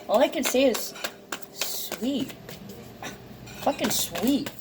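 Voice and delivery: high, softened voice near tears; really choked up by sweetness; tearful, touched. Real, not acted. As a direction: say it moved and sniffly. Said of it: "Okay, now I'm sniffling".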